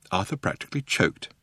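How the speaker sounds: ordinary delivery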